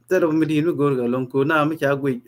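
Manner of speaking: normal talking voice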